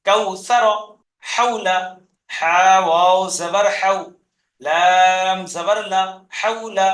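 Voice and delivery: measured model reading, "হাউলা" whole then in parts; spelled piece by piece. Shown as a demonstration. Teacher modelling word spelling for pupils, slow segmented recitation. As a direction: set each part apart, then join up "হাউলা". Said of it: "কেটে কেটে বানান করবেন".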